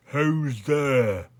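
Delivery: loud, stretched challenge; voice from darkness. A narrator's reading. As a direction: call out into the dark. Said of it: "said a voice from the blackness".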